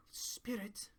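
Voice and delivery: breathless, hushed whisper, slow on the question; frightened whisper. Retold or imitated. Scrooge whispering to the spirit, a fearful hush. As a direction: whisper it, dreading the answer. Scrooge pleads in Mickey's Christmas Carol.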